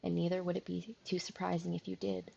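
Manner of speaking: calm, measured storyteller read; story narration. A narrator's reading. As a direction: draw the listener into the scene. Reads visual novel narration.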